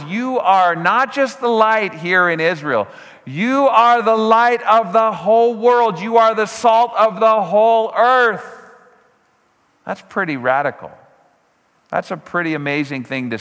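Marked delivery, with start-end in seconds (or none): 0.0-8.7 slow, louder, higher proclamation; preaching Jesus' words. Acted. Preacher voicing Jesus telling followers they are light. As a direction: proclaim it to the whole world.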